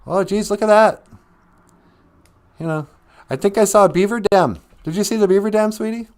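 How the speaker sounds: high, loud chatterbox voice; oblivious, high-pitched prattle; wife rattling on. Acted. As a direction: rattle off every sight at top pitch. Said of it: "she kept on rattling off".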